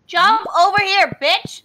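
loud, high mock yell at a pet. Real, not acted. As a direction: bark it at the critter, half joking. Yelling at game animal.

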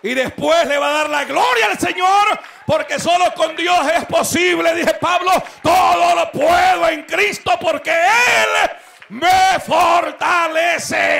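loud, high, slow preacher's shout; preaching at full force. Acted. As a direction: thunder the verse at the congregation.

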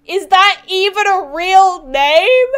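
loud, slow, high sneer; snide mockery. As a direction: sneer at the name. Character mocks the name.

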